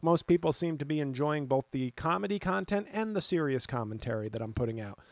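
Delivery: ordinary delivery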